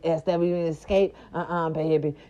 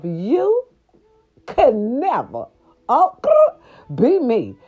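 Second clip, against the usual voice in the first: high, drawn-out sassy taunt; growling diva taunt; diva diss; 6 semitones higher, 4 dB louder, much slower than usual. Retold or imitated. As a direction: dare her, with a growl in the middle. Acting the queen's diss.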